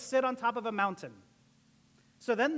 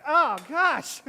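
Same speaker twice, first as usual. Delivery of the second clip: mock dismay; 3 semitones higher, 8 dB louder than usual. Reacting to imagined bad news.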